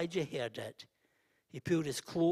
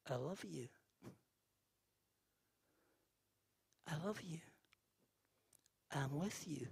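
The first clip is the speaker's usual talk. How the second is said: soft, near-whispered voice of God; still small voice; 8 dB quieter than usual. Acted. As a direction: speak it tenderly, barely above a breath. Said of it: "a still small voice comes".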